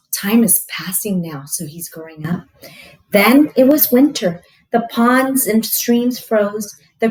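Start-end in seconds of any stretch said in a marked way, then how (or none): none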